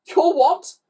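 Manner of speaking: high, loud incredulous question; grunted disbelief. A narrator's reading. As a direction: blurt it out in disbelief. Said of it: "grunted Glenn".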